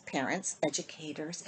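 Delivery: ordinary delivery, nothing marked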